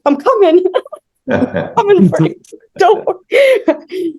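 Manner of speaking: high, singsong mock-urgent call to a baby; retold calling out. Mother imitates herself running to her crying baby, calling out. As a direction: call out reassurance in a high voice, half laughing.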